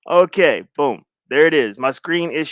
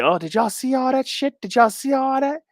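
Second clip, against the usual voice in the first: high, thin mock onlooker voice; squeaky put-on busybody chatter; imitating gossiping viewers; 8 semitones higher than usual. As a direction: mimic scandalized viewers in a high voice. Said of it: "let me see who's in here, be like".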